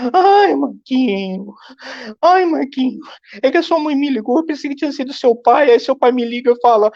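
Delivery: high, breathless aunt rushing her excuse; flustered aunt. Retold or imitated. Aunt on the phone explaining her mistake, high and flustered.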